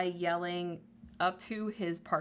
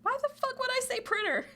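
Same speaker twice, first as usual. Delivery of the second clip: shrill meme yell, much higher; squeaky angry shout; faster than usual. Retold or imitated. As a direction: screech in outrage. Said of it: "repeated meme line".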